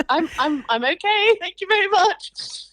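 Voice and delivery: mock prim brush-off; mum's polite refusal. Imitating mum refusing unwanted help. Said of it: "she's like".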